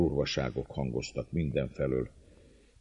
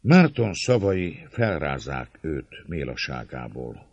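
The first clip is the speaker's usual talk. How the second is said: ordinary delivery, nothing marked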